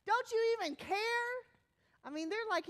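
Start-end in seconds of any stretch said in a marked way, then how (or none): 0.1-1.0 high, loud indignant demand; incensed. Acted. Disciples to a calm Jesus in the storm, incensed. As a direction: throw it out as an outraged challenge. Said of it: "incensed that Jesus is".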